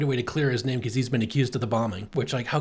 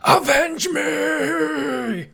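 long drawn-out dying cry, very high; overacted death scene; 13 semitones higher, 5 dB louder, much slower than usual. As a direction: wail it out as you die. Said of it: "and he's like".

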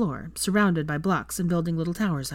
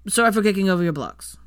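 hurried, louder, grudging apology; rushed; 3 semitones higher, 6 dB louder than usual. A narrator's reading. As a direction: get the apology out in one rush. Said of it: "said in a rush".

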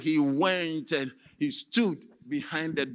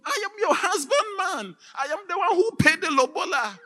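very high, tearful pleading; crying plea; 13 semitones higher, 7 dB louder than usual. Acted. Husband pleading through the door, crying plea. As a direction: beg her through tears.

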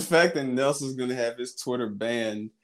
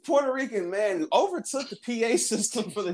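mock news report; 8 semitones higher than usual. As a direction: announce it like local news. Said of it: "The news report".

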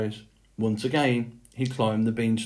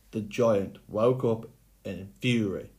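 usual speaking voice

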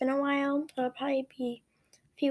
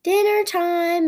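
high, loud, slow sing-out; calling out; 6 semitones higher, 8 dB louder, much slower than usual. A narrator's reading. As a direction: call the family from across a yard. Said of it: "my dad calls from the backyard".

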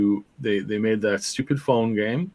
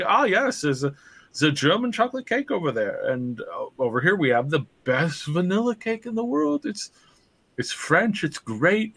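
a hint of a higher-pitched shopkeeper; lightly voiced shopkeeper; 6 semitones higher than usual. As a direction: show off your goods in a raised mock sales voice.

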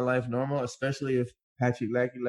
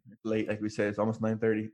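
normal talking voice

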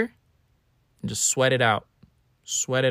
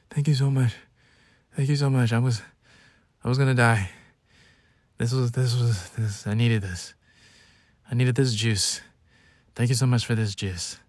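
hammed-up weak, winded gratitude; mock huffing and puffing. Sick drama king thanking whoever brought juice, mock winded. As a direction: thank them as if barely saved from death. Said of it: "I'm huffing and puffing".

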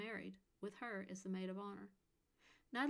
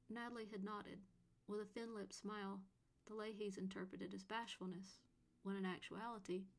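plain reading voice

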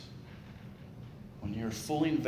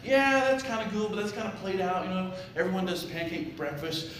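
chicken voice; 8 semitones higher than usual. Acted. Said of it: "the chicken's like".

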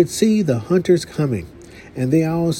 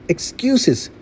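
scoffing hunter; 8 semitones higher than usual. A narrator's reading. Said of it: "said the hunter".

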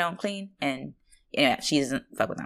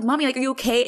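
freaking out; 8 semitones higher, 4 dB louder, faster than usual. Retold or imitated. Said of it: "I'm, like, freaking out".

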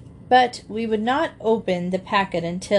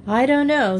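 higher, louder note of doubt; doubtful; 6 semitones higher, 5 dB louder than usual. A narrator's reading. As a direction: object with open doubt. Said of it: "said Dan doubtfully".

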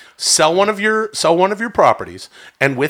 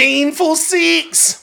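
high, drawn-out Aussie parody; mock Australian accent; 12 semitones higher, much slower than usual. Hosts parodying the departed caller, high mock Australian accent.

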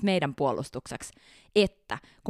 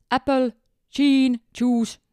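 apple, gin, juice said Finnish-style, a clear pause after apple, gin as chin; Finnish-accented English; 5 semitones higher, 5 dB louder, much slower than usual. Retold or imitated. A Finnish passenger orders from the steward in broken Finnish-accented English. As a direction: mimic a Finn choppily ordering apple juice. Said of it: "Lausui sen vielä niin, että siinä oli sekunnin tauko".

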